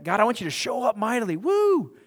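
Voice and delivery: hammed-up plea ending in a high whoop; imitating a hyped believer calling for a sign; mock fervent prayer. Mock prayer wanting a show.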